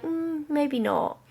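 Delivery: high, slow, soft maybe not; lightly voiced courteous refusal; polite horse voice. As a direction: gently decline like a well-mannered horse. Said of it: "so polite and genuine".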